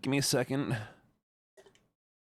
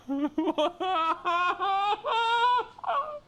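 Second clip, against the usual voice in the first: high giggle. Real, not acted. Laugh after high panic.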